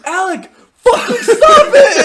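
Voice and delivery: very high, loud, drawn-out outburst; mock furious yell. Kid with rage issues at piano unplugger, mock yelling. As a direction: scream it hammed-up, on the edge of laughter. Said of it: "like, yelling".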